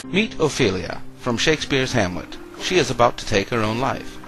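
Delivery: ordinary talk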